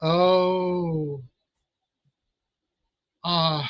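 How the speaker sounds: ordinary delivery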